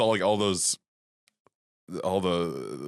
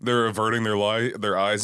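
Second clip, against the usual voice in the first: ordinary delivery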